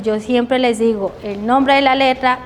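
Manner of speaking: normal talking voice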